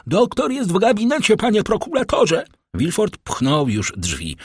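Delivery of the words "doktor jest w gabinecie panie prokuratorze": very high-pitched servant's call; acted high holler to the master. A narrator's reading. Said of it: "i zawołał tylko".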